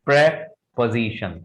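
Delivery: Preposition split as "prep... uh-zi-shun"; slow Preposition, pause after the short "prep"; correct pronunciation. Shown as a demonstration. Teacher modelling the word with care, broken in two. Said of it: "थोड़ा सा ध्यान रखना है".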